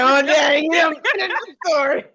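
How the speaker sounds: loud, high, fast mock cry-shout; hammed-up loud wail; mock shocked shout. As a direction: holler it in fake shock. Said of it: "I was like".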